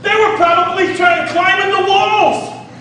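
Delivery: high and keen, still worked up; excited. Acted. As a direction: paint the panic with energy. Preacher dramatizing panic.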